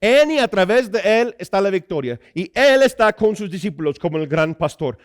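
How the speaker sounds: high-energy pulpit delivery; emphatic preaching. Acted. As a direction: proclaim it to the congregation, stretching key words.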